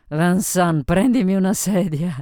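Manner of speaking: loud, high call to a waiter; drunken order. A narrator's reading. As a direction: order him about at full voice. Drunk Sophie orders passing waiter about.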